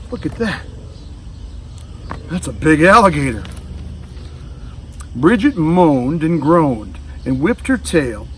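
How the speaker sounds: usual speaking voice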